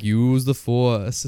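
low, very slow and drawn out; deep, unhurried sage voice; Obi-Wan Kenobi. Acted. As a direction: intone it slowly as an old mentor. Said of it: "his little Obi-Wan voice".

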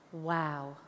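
lightly acted, low awed wow; hushed lovestruck quote; quoting smitten Aladdin. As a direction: say it low, as if smitten at first sight. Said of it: "he says, and I quote".

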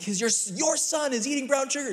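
put-on pointing the finger at the kid's mother; exasperated dad blaming. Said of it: "you instantly just point at".

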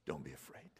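low, quiet tender voice; soft comfort. Acted. Preacher voices Jesus' final comfort, low and quiet. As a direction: breathe it out gently. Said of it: "hear my words".

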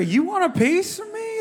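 high, challenging tough-guy taunt; tough-guy attitude. Shown as a demonstration. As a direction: sneer it out like John Wayne. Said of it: "you gotta say it like you got a little bit of an attitude".

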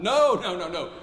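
loud, high, dismissive gatekeeper; a bully turning someone away at the door; sneering bully. Retold or imitated. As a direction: shut them out with a mocking no. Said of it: "Bullies who push people away".

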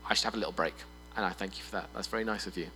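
half-hearted thanks; sheepish mumble. Acted. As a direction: mumble it off politely. Polite brush-off to God.

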